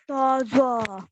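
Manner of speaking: "तो ज़ो" with full, rounded vowels; heavy letters. Shown as a demonstration. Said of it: "मतलब heavy word है".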